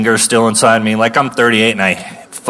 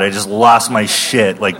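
ordinary talk, nothing marked

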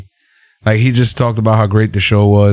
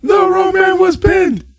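Samoa Joe yell. Retold or imitated. Friend echoes the Undisputed Era yelling at Regal. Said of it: "their best Samoa Joe impression, yelling".

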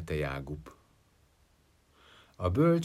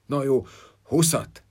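dismissive; 6 semitones higher, 8 dB louder than usual. A narrator's reading. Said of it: "legyintett a halál".

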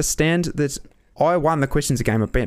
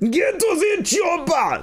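much higher, slow shouted movie line; mock action-hero shout; slower than usual. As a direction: bellow it slowly like an action hero. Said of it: "me mate Hitler".

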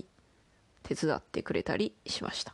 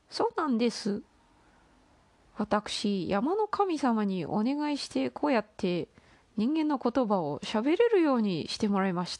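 put-on higher, bright young crane girl; 5 semitones higher than usual. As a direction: lift the voice like a shy girl. Crane explains her powers.

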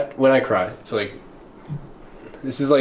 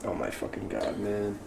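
whispered, much softer, overcome; choked whisper; 2 semitones lower, 14 dB quieter than usual. Retold or imitated. Reacting to a sad TikTok, hushed overwhelmed whisper. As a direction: breathe it out, moved to tears. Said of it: "and I was like".

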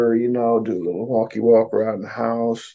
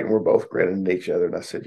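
ordinary talk

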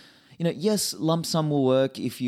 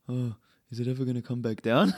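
a hint of a worried mum; lightly voiced fretful mother; imitating his mum. Son mimics his mum fretting over the price, lightly. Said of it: "now she's like".

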